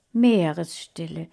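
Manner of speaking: ordinary delivery, nothing marked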